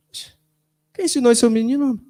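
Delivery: high, amused exclamation; mock surprise. Wife surprised at the little boy copying his dad, a high amused exclamation. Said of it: "Ficou assim".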